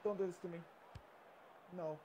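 usual speaking voice

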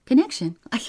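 high, loud, stammering puzzlement; flustered. A narrator's reading. Clerk questioned by employer about their link, flustered. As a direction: stumble into a startled answer.

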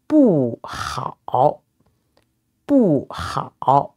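"bu" falls, then "hao" dips low; fourth tone, third tone. Shown as a demonstration. Teacher modelling "bu hao" for learners, falling then dipping. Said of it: "followed by a third tone".

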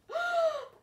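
high, loud theatrical gasp; big mock gasp. Reader to children, high loud theatrical gasp. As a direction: gasp hugely for the kids.

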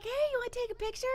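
put-on high, very fast kid's voice; eager little boy. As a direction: chirp it quickly like a keen child. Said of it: "He's like".

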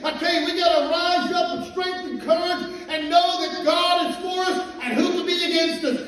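loud, building pulpit exhortation; fiery preaching. Acted. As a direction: rouse the congregation to hold on. Preaching to the congregation.

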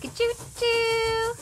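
high, sustained train hoot; train whistle imitation. Showing a toddler a train sound, high whistle.

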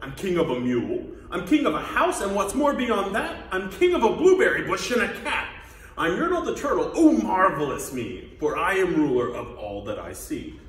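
loud, boastful turtle king; crying out. Acted. Yertle the Turtle gloats over all he rules, crying out. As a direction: proclaim it like a self-crowned king. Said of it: "Yertle cried".

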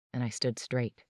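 ordinary delivery, nothing marked

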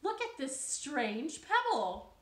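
put-on high, loud, thrilled young voice; excited frog. Jessica calling her friends, thrilled and loud. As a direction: show off the find, bursting. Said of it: "and she said".

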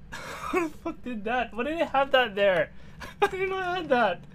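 fast, high laughing talk. Real, not acted. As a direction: laugh through the shock. Laughing after fright.

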